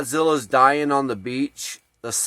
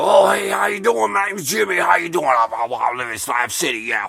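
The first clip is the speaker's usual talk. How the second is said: Papa Jimmy impression; 4 semitones higher than usual. Camp moocher running out to slowed cars, hollering boozy greeting. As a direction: imitate a boozy wrestler hailing strangers. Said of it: "try to do an imitation of Jimmy's voice".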